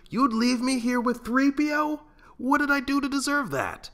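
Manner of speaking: hammed-up horror at being left with him; mock outrage. A narrator's reading. Han outraged at robot babysitter.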